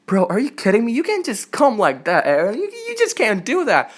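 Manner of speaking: high-pitched, swooping disbelief; incredulous protest. Retold or imitated. Fan protesting to Eren's sudden arrival, incredulous. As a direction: retell it as a baffled, rising objection.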